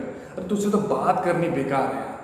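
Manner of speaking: ordinary delivery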